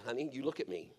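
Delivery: put-on soft, calm comfort to a scared child; reassuring daddy. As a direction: hush her fear gently, like a dad at the bathtub. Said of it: "I said".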